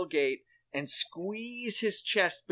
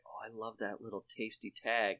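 soft fond aside; 5 semitones lower, 9 dB quieter than usual. Retold or imitated. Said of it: "I was like".

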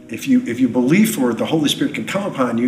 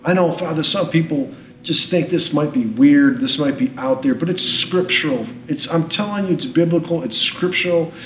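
high, impassioned sermon; fervent preaching; 6 semitones higher, 4 dB louder than usual. Real, not acted. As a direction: preach with rising passion. Preaching at full force.

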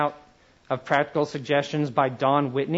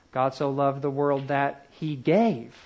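pause, then swoop down through "gave"; stress on GAVE; slower than usual. Shown as a demonstration. Teacher moving through the verse word by word, stress on GAVE. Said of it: "emphasize one word at a time".

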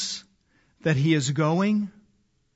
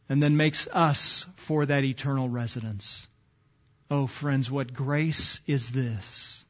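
much lower and softer, full of wonder; awed hush; 6 semitones lower, 7 dB quieter than usual. Real, not acted. Preacher marveling at grace to his friends, hushed and much softer. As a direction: marvel at it quietly, slowing down.

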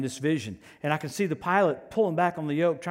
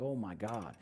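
low, much softer mock dismay; dismayed aside; 6 semitones lower, 9 dB quieter, slower than usual. Preacher recalls hearing pastor's flying plan, quietly dismayed. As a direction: drop it under your breath, quietly aghast. Alarmed inner reaction to pastor's news.

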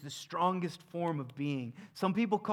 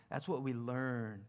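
normal talking voice

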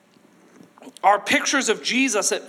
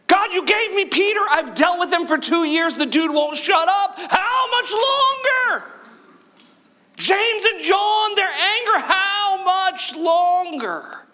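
high, shouted exasperation, dragging out how much longer; frustrated Jesus; 11 semitones higher, slower than usual. Retold or imitated. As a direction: vent to the Father like you're at the end of your rope. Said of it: "Jesus just going".

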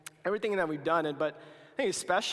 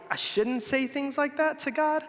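higher, sheepish self-scolding; guilty inner voice; 8 semitones higher than usual. Retold or imitated. Youth pastor voicing a worried believer, higher sheepish tone. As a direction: put on a high, small voice of someone scolding themselves. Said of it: "but you're like".

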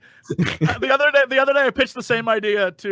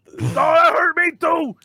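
higher crying ogre shout; pained ogre voice; 8 semitones higher than usual. Acted. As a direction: wail it like a hurt ogre. Ogre role-play fight.

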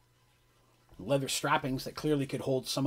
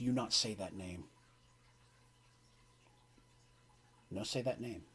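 low dwarf warning; 5 semitones lower, 8 dB quieter than usual. Acted. Dwarf angered, warns lowly.

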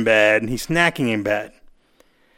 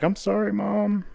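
higher, softer apology; mock contrite kid; 7 semitones higher, 5 dB quieter than usual. Boot camp kid apologizing to mom, mock contrite. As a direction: imitate a tearful kid saying sorry, softly. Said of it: "Yeah, and they're crying".

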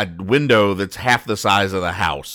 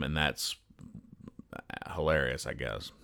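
dry, dropping to a near mumble; trailing sarcastic aside; sarcastic deadpan; 6 semitones lower, 15 dB quieter than usual. Real, not acted. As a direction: say it like it isn't funny at all.

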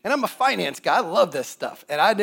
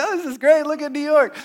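gushing wife; 10 semitones higher, 4 dB louder than usual. Retold or imitated. Newlywed wife voiced gushing.

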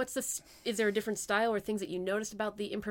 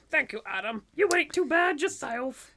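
high, louder puppet voice to deflect praise; Muppet voice; 5 semitones higher, 5 dB louder than usual. Pretend. Improviser thanks co-host for a compliment, Muppet voice. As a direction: put on a Muppet voice, uncomfortable with praise. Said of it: "that's her Muppet voice".

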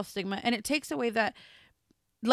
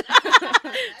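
high, loud burst of laughter at her own slip; big laugh; 11 semitones higher, 8 dB louder than usual. Real, not acted. Mom laughing at her own mix-up, loud high laugh. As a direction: crack up out loud.

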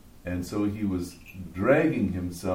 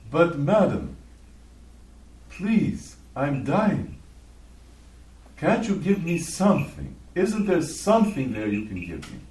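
raised, louder beggar's plea; desperate pleading; 5 semitones higher, 4 dB louder than usual. Acted. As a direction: beg as a starving man who was refused. Starving beggar begs after being sworn at.

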